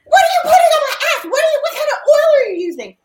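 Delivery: high, fast laughing shriek; mock her shrill outrage, half laughing; imitating shrieking daughter. Daughter shrieking at mom about the cooking oil. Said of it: "And this bitch says".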